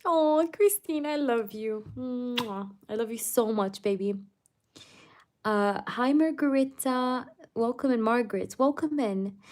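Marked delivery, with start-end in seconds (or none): 0.1-4.1 sweet nothings. Real, not acted. Gushing love to a viewer.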